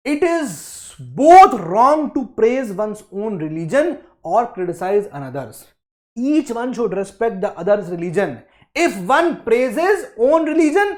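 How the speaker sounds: slow, loud, deliberate quote reading; emphatic reading aloud. Acted. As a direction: read the passage out word by word for a class. Reads the textbook quote aloud.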